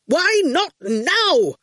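high, slow angry shout. Acted. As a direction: lose your temper. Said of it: "Quentin shouted".

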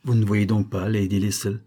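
plain reading voice

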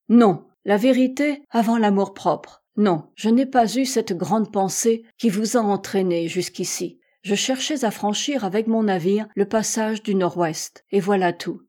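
ordinary delivery, nothing marked

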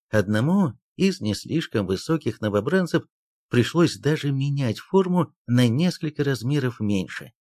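plain reading voice

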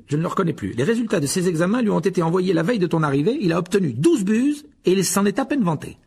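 gossiping about his brother; lightly voiced Fred. Fred speaking. (frère moqueur, voix plus haute)

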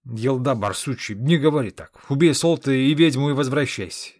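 a giant bellowing at his departing friend; loud giant. Acted.

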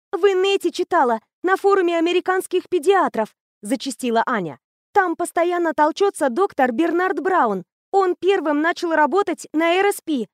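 put-on high, bright-eyed girl raving about a machine; eager teenage girl. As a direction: gush like a know-it-all teen. Anya voiced high.

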